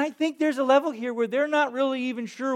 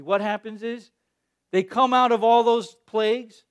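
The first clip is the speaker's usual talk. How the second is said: ordinary delivery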